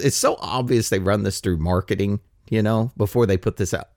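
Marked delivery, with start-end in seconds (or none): none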